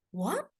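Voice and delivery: "What" sweeping sharply upward; questioning "What" with a steep rise; second tone. Acted. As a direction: ask "What" as a climbing tone. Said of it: "the second tone is like".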